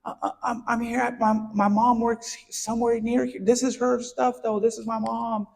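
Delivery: lightly voiced small boy, high and pleading; frightened child voice. Little boy pleading with the owner, a hint of crying. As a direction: plead like a scared little kid. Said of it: "And I'm crying".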